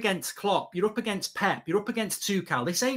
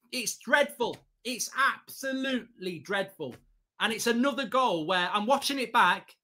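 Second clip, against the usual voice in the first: ordinary talk, nothing marked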